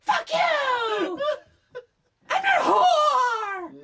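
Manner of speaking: laughing hammed-up yell, high and loud; mock Nomi yelling, half laughing; mock furious shout. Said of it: "is like".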